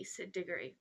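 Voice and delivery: ordinary delivery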